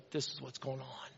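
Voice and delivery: lightly voiced huddle leader, hushed; quiet confession. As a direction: drop it to a hushed, heavy admission. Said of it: "And she just said".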